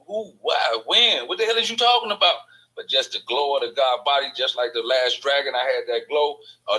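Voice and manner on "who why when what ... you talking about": high, loud string of incredulous questions; acted bafflement. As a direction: voice shooting up in disbelief as you retell it. Acting out his baffled reaction in story.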